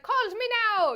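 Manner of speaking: a light imitation of a Miss Cleo Caribbean accent, loud and high; hammed-up Miss Cleo Caribbean psychic call; mock Miss Cleo. Friend mocks the film's accents, light Miss Cleo Caribbean accent. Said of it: "Miss Cleo".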